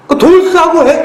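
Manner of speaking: mock yell of 돌쇠하고 해, high and loud; mock angry yell. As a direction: fling 돌쇠하고 out as a retort, half-joking. Mock literal angry retort.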